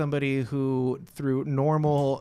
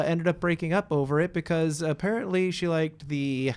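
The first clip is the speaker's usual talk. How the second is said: usual speaking voice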